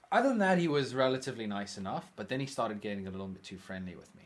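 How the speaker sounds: normal talking voice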